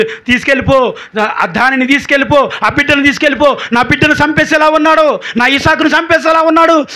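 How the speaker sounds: mild mock insistence, each తీసుకెళ్ళిపో pressed with a falling end; nagging demand. Sarah pressures Abraham to send the boy away, insistent. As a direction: push the demand over and over. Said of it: "బాగా భారం పెట్టి".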